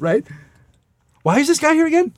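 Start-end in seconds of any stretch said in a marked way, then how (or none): 1.2-2.1 mock crew complaint. Mocking crew member's question.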